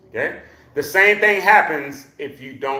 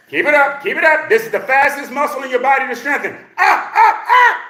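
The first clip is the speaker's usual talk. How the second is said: high urging, climbing to loud whoops; higher voice; 8 semitones higher, 4 dB louder than usual. Shown as a demonstration. Coach urges class up on their heels, voice climbing high. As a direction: drive them upward with a rising pitch. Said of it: "when my voice goes higher".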